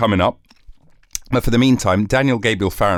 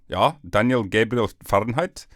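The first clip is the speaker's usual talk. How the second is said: usual speaking voice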